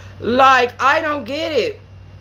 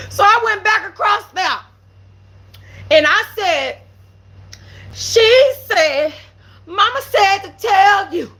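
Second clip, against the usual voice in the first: excited shout; 9 semitones higher than usual. Real, not acted. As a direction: shout it out between laughs. Climax of story, shouting, laughing.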